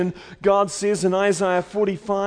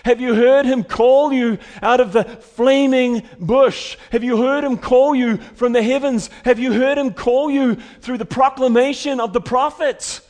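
preaching at full force; 4 semitones higher, 5 dB louder than usual. Acted. Preaching climax.

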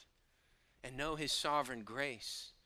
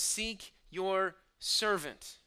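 loud, slow cry of "Seek your servant", each word drawn out; passionate cry; 6 semitones higher, 7 dB louder, much slower than usual. Shown as a demonstration. Preacher crying out the psalmist's plea, slow and loud. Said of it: "the passionate cry when he says".

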